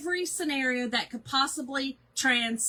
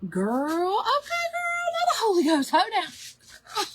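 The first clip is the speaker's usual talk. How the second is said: mock church praise; 10 semitones higher, slower than usual. Mock Pentecostal church shout.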